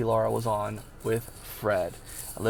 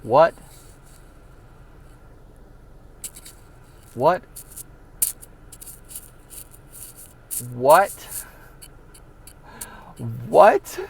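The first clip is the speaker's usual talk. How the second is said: disbelieving cries, climbing to a yelp; stunned what; 2 semitones higher, 5 dB louder, much slower than usual. Retold or imitated.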